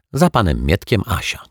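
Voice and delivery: ordinary delivery, nothing marked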